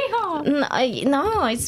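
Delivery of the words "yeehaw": high Southern cowboy yeehaw; mock-Southern whoop, higher pitched. Shown as a demonstration. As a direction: toss off a token Southern yeehaw. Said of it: "I cannot do Southern".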